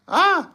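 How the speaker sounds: short, high exclamation; high yelp of reaction; high-pitched "ah". Real, not acted. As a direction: let out a sudden high "ah!". Reacting to team going home.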